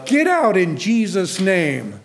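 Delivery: slow, loud rebuke of a demon; a preacher's rebuke, firmly spoken; mock deliverance command. Shown as a demonstration. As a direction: command it out, slowly.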